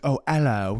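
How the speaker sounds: toned-down British hello; British accent. Acted. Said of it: "so he's like".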